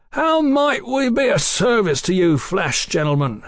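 high, hearty call-out; jovial hail. A narrator's reading. Jovial hail up to armed men.

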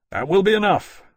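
sharp, loud warning; dangerous. Acted. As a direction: cut them off with menace. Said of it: "Lawrence said dangerously".

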